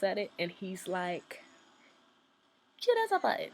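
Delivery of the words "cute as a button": squeaky high, odd little accent, mock-imitating Liam; weird little accent. Fan imitating Liam pointing at her sign, in a weird little accent. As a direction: copy his quirky accent, pitched way up. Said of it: "he says it in the weirdest little accent".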